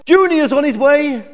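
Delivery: high, loud call of a mother-to-be; high-pitched thrilled announcement; excited shout. A narrator's reading. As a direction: holler the big news to your mate. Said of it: "she shouted".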